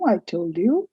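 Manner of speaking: ordinary talk, nothing marked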